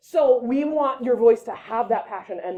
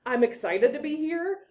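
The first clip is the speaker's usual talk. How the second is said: excited to be here; 6 semitones higher than usual. Shown as a demonstration. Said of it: "we want your voice to come across as".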